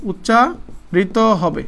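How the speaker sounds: উচ্চারিত broken in pieces with a pause inside; deliberate, halting teacher's dictation; slow dictation. Shown as a demonstration. Teacher dictating the rule slowly.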